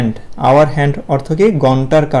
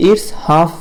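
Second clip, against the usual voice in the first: slow model phrase; much slower than usual. Shown as a demonstration. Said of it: "আমরা বলবো".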